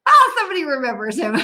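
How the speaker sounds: quick, real burst breaking into laughter; amused delight. Real, not acted. Host reacting to a viewer who remembers the old impressionist, amused delight. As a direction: exclaim it fast, about to laugh.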